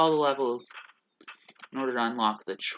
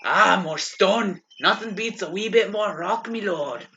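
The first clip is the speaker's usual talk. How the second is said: put-on Scottish accent; 7 semitones higher than usual. Imitating the Scottish mason.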